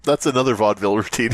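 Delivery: usual speaking voice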